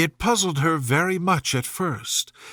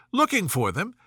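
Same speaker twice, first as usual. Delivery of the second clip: ordinary delivery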